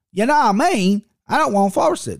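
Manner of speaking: put-on high-pitched playful English accent; mock English accent.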